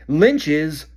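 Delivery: loud yell. Acted. Harry yelling over the crowd, loud. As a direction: shout the correction. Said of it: "yelled Harry".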